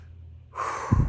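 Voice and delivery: big audible breath, breathy and long; deep breath. Shown as a demonstration. Teacher demonstrating a deep breath for the class, breathy. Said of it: "Can you take a deep breath?".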